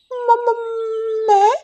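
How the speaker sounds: high, stuttering parrot panic; scared parrot stammering; frightened stammer. Acted. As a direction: trip over the first word in fear. Parrot caught by angry Gauri.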